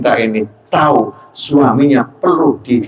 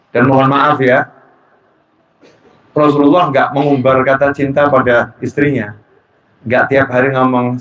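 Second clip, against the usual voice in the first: ordinary talk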